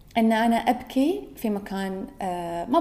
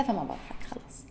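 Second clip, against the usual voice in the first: whisper; 11 dB quieter than usual. Real, not acted. Quiet conclusion to viewers.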